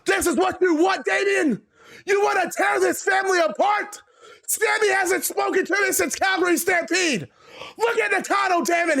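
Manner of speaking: high, shouting furious boss; angry shout. Acted. Angry boss shouting at his rebellious son figure. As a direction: yell at him, wounded and furious. Vince yells at Damien in ring.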